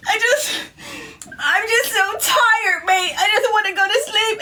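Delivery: high, whimpering British mate; crying friend. Retold or imitated. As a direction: sob it out, mock British. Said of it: "she's like".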